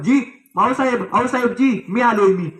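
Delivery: high, eager shout to be heard; a young lad calling out over the guards; boy calling out. Acted. Boy at the gate calling out to the queen mother, high and eager. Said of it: "ओरडायला लागलं".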